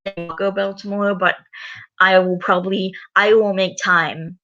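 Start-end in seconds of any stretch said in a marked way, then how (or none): none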